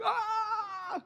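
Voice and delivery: high, sudden scared cry; mock scream. Kids frightened by grandma's scripture give a high yelp.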